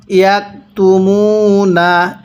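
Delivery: slow, drawn-out tajweed chanting; slow Quran recitation. Shown as a demonstration. Teacher demonstrating recitation to students, slow chant. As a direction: recite each syllable long and measured. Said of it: "এরকমভাবে পড়ব".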